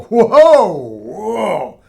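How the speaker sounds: loud astonished whoa, falling away; amazed whoa. Retold or imitated. To a listener, imitating a client's astonished whoa. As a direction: blurt out the wonder of new energy. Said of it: "you're gonna go".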